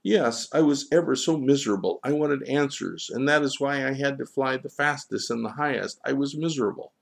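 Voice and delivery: high, fast, miserable confession; agitated gull owning up to misery; Johann the gull, distressed. Acted.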